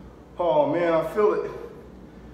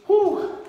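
loud high cheer; triumphant whoo; 5 dB louder than usual. Real, not acted. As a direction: whoop after the set. Cheering after the set.

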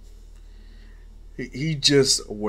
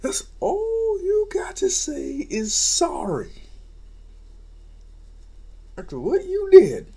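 contemptuous voice. Acted. Policeman rejects Izuku's apology.

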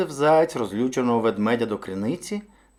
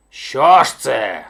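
loud, slow indignant bear roar; drawn-out growly roar of outrage; 9 dB louder, much slower than usual. A narrator's reading. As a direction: bellow it out like a hungry bear. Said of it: "ревів ведмідь".

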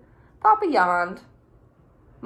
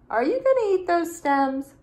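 high, louder eager ask; hungry for the leftovers; greedy little snail voice; 9 semitones higher, 4 dB louder than usual. Acted. Snail eyeing family's leftovers.

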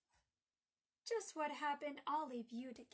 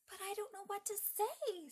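put-on high, upset little-girl whine; tearful; 7 semitones higher, 4 dB louder than usual. A narrator's reading. Margaret to Donald about praying, tearful whine. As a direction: protest helplessly like a tearful child. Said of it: "amid the tears and sobs".

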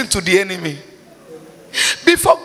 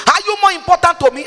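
angry God voice; 6 semitones higher, faster than usual. Acted.

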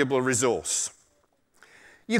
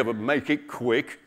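lower, slow tiger; lightly voiced tiger, drawn out; 3 semitones lower than usual. Tiger to the muskrat, lightly voiced slow warning. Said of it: "said the tiger".

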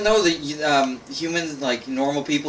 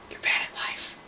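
whispered insult; 10 dB quieter than usual. Real, not acted. Whispered jab after joke.

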